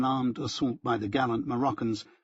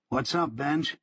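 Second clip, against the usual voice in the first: mildly put-on cool, a little higher; coolly casual; 4 semitones higher, slower than usual. A narrator's reading. Greeting the running Benjamin, as coolly as possible. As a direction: ask it as coolly as you can. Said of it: "I said as coolly as I could".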